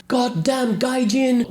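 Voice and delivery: very high, loud, drawn-out mock rage; imitated angry yell. Japanese man yelling after departing foreigners, imitated angry yell. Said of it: "the Japanese guy is yelling".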